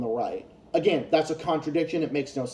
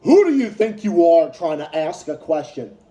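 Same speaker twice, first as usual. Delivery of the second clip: louder mocking sneer at a questioner; sarcastic imitation of a smug put-down; condescending mockery; 7 dB louder than usual. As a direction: belittle him, imitating the host. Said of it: "literally talking down to him".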